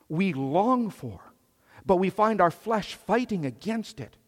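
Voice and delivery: loud, high, driving pulpit voice; fervent preaching. Acted. Pastor preaching to the church, loud and fervent. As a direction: preach it hard to the congregation.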